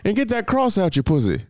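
high furious dad shout; yelling dad. Retold or imitated. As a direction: scold like an outraged father. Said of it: "he's like".

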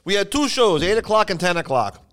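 exasperated club owner. Retold or imitated. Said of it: "He goes".

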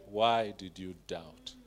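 tender disappointed question; gentle Jesus. Acted. Jesus voiced rebuking Peter.

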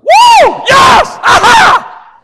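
whooping, shouting for joy; excited whoop. Pretend. Preacher showing the crowd how excited he'd be. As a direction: burst out cheering, way up high. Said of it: "I would be like".

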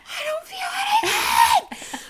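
very high, drawn-out acted scream. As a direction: shriek it in mock labor agony.

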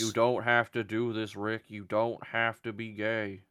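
slow, pleading character impression; Herschel. Talking to Rick through the fence, character impression. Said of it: "That's Herschel talking to, through the fence".

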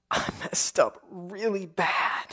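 soft, slow confession; sorrowful, guilty cry. Acted. Preacher voices Peter's remorse after the denial, quiet sorrow. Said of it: "This is a cry of sorrow".

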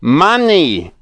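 excited shout of money. A narrator's reading. Moonface shouting to the saucepan man. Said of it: "shouted Moonface".

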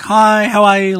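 high, loud cheery greeting voice; mock greeter. Mimicking a restaurant host welcoming a customer, high and bright. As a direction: chirp it like an eager restaurant host.

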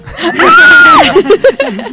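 high burst of laughter; real shrieking laugh; loud laughing shriek. Real, not acted. Laughs at joke about charging.

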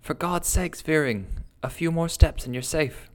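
high, pleading shout; desperate shout up the slope; urgent shout. A narrator's reading. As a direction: call out to save him. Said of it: "I shouted".

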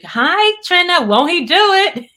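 high, loud, laughing proclamation; excited shout breaking into laughter; exuberant call-out. Real, not acted. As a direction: holler it joyfully to the congregation. Exuberantly greets viewer mid-proclamation.

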